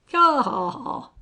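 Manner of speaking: loud, high "Ha, ha, ha"; hearty laugh. A narrator's reading. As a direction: burst out laughing along. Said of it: "seized ... by the human disorder of laughter".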